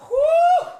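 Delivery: high, loud "woo"; excited whoop. Real, not acted. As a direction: let out a piercing cheer. Hyping himself up for the drive.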